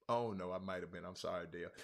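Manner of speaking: low, soft, sheepish concession; real hushed admission; quiet apology. Real, not acted. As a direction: drop right down to apologize. Conceding a mistake to viewer.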